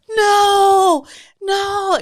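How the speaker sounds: long, high, very loud mock scream; kids' panicked wail. Kids after the name is said, panicked wail. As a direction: imitate a roomful of horrified kids. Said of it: "And the kids go".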